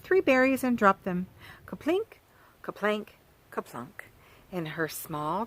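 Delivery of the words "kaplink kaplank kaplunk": sound effect words. Acted. Berries drop in pail.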